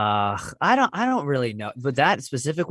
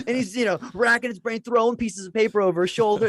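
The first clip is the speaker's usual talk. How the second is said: normal talking voice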